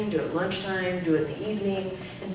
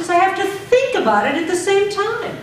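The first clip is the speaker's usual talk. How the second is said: projected drill line; 6 semitones higher, 8 dB louder than usual. Shown as a demonstration. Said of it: "repeat drill".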